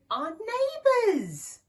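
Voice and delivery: high, loud, slow yell. Acted. Sam yelling his idea to Shifty, high and loud. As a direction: shout it out with a whoop. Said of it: "yelled Sam".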